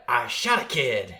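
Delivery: slow, drawn-out movie cop confession; Reginald VelJohnson impression. Podcaster quoting the Die Hard joke, slow cop impression. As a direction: imitate the Die Hard cop, stretched out slowly. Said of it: "It's the way he says it is really funny".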